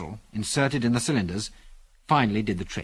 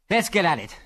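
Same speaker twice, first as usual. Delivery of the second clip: higher, urgent call to action; keen, raised-pitch exclamation; eager cry; 7 semitones higher than usual. A narrator's reading. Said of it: "he cried".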